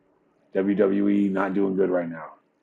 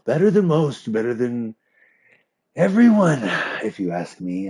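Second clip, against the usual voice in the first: high, smug villain voice jumping much higher and louder on peaks; mock JP voice; 4 semitones higher, 4 dB louder than usual. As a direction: ham up a gloating rule-abusing boss. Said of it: "He's literally".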